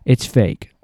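agitated. Real, not acted. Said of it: "I'm getting so agitated".

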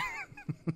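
high laugh. Real, not acted. Laughs at the apps.

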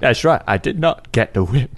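light Cockney film star voice; Michael Caine voice. Pretend. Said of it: "your Michael Caine".

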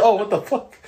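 high, disbelieving outburst; exasperated retort. Retold or imitated. Guard retelling his answer, disbelieving outburst. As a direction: throw it out in disbelief. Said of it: "I said".

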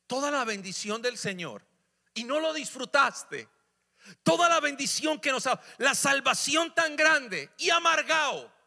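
high, loud, slow indignant cry; building from mild to full outrage; rising rebuke. Acted. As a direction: build the outrage toward the end. Preacher rebuking ungrateful people.

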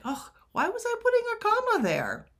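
high, quick, fed-up whine; exasperated groan. Pretend. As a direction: roll your eyes at your old self. Mock exasperated self-quote.

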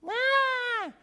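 high loud demon shout; demon shriek. Retold or imitated. Demon interrupting the preacher, shrieking loud. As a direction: screech like a possessed man. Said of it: "he goes".

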